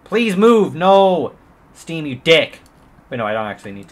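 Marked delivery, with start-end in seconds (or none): 0.0-1.3 high, loud yell at the screen; frustrated shouting. Real, not acted. Frustrated yelling at stuck game. 1.7-2.6 loud cursing snap; angry outburst. Real, not acted. Cursing the game loudly.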